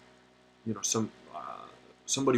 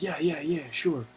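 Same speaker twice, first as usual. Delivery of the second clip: higher, louder eager yes-man imitation; eager coworker; 7 semitones higher, 4 dB louder, slower than usual. Coworker eagerly agreeing to join the Freemasons, higher and louder.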